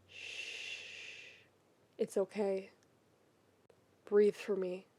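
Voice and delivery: soft, hushed comforting whisper; calm him down gently; soothing hush. Acted. Shiro soothing Keith through his panic, whispering.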